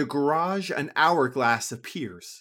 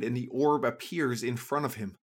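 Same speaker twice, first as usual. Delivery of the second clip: ordinary delivery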